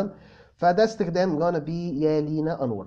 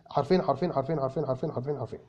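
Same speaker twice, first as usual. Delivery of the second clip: fading repetition; 5 semitones lower, 6 dB quieter, faster than usual. Real, not acted. Repeating phrase while trailing off.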